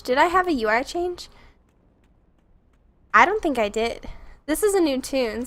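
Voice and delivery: usual speaking voice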